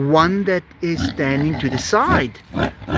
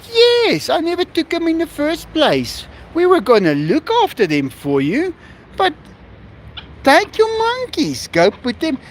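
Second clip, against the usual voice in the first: retold cheerful insistence; 10 semitones higher than usual. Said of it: "I said".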